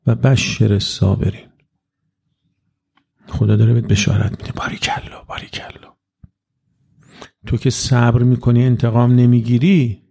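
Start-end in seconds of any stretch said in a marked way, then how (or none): 4.5-6.0 whispered well done, barely voiced; soft praise. God whispering praise to the patient one, hushed. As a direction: breathe the praise as if God murmurs it.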